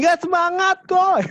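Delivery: high voice. Shown as a demonstration. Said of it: "nada tinggi".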